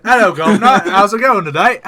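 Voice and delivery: loud, high, badly faked Australian; hammed-up Australian that misses the accent; failed Australian accent. Host showing how his Australian goes wrong, badly faked Australian accent. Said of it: "when I try to do an Australian".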